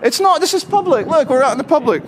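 high-pitched, urgent objection; insistent rebuttal. Real, not acted. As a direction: argue back with the pitch jumping up. Arguing back against the guard.

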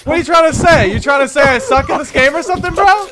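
loud, high-pitched challenge; shouted playful outrage; indignant confrontation. Pretend. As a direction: demand an answer, pitch jumping up. Streamer confronts kid over criticism.

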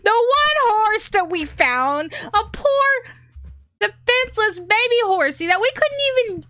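high, slow, crying outcry; anguished wail. Real, not acted. After Arsenal kills the baby horse, a high anguished wail. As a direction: wail in grief at the loss.

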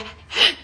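unvoiced sudden gasp; breathy gasp. Shown as a demonstration. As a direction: gasp with fright. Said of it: "suddenly I got scared".

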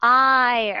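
I said loud and drawn, a clean long vowel; clear I sound. Shown as a demonstration. Teacher drilling the class on the I vowel, loud. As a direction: belt out a clear model I. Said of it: "đọc cái âm I rất là rõ ràng".

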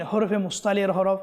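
ordinary delivery

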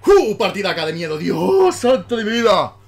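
ecstatic celebrating. Real, not acted. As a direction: scream with victory joy. Celebrating a win in game.

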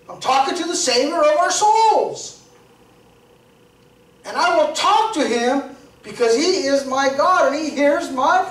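high, rising sermon voice, pressing each line; impassioned preacher building to a climax; fervent preaching. Acted. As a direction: preach it with growing passion.

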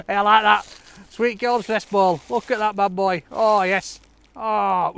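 delighted. Real, not acted. Thrilled vlogger showing the gift to the cameraman, loud and excited. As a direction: gush over the present.

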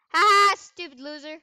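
loud jeering laugh into an insult; mocking taunt. Real, not acted. Gamer mocking other players, loud jeering taunt. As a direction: jeer loudly at the losers.

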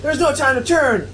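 high panicked cartoon hero cry; high hammed-up anime hero yelp; panicked Goku. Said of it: "He's like".

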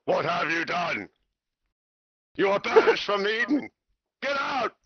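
mock thundering God; God's thunder voice. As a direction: thunder down the banishment like an angry God. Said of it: "when the thunder's voice comes down".

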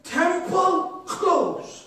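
slow, drawn-out read of the note; reading the temple note aloud, slow and amazed; slow reading of the sign. Retold or imitated. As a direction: spell out the sign word by word in wonder. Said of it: "It says".

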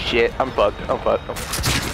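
low, sinking mutter of defeat; doomed muttering. Real, not acted. Despairing mid-fight.

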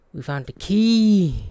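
loud, slow, thrilled yell; excited shout. Real, not acted. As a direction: shout it out in triumph. Finds key after long search.

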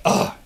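collapse into an exaggerated "ugh"; tired groan. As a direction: pretend worn-out grunt. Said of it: "we get tired".

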